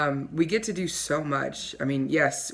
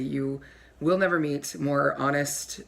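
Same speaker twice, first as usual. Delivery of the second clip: ordinary talk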